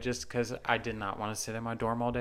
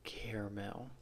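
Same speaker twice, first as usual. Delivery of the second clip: caramel whispered under the breath; 2 semitones lower, 13 dB quieter, slower than usual. Real, not acted. Friend whispering her version of caramel. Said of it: "Sounds like too many syllables".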